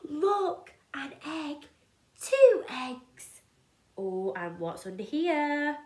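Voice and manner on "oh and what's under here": ordinary delivery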